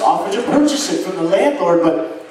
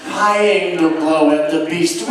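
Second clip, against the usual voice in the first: usual speaking voice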